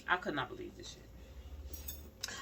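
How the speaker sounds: whispered disbelief, slowed down; slow whispered outrage. Real, not acted. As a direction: hush it like you can't believe it. Outrage at police shooting.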